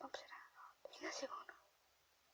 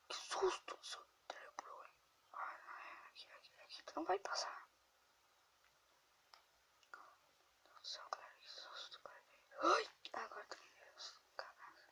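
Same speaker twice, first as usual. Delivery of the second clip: scared whisper; 7 semitones higher, 8 dB quieter than usual. Real, not acted. Whispers while grandma sleeps.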